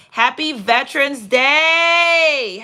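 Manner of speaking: loud, high, long-drawn-out DAY; jubilant holiday shout. Real, not acted. Host hails veterans among the viewers, jubilant shout. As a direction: call it out big and stretch the last word.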